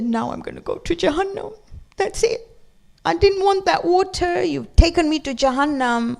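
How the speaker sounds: put-on high, childlike wail of a scared five-year-old; little girl panicking. Girl tells the imam she's doomed, high childlike panic. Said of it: "And she said".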